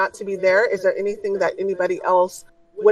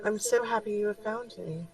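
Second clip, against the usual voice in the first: soft, gentle spirit voice, much softer; kind and compassionate; 3 semitones higher, 10 dB quieter than usual. Acted. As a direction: speak like a loving guide. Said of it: "in a very kind and compassionate tone".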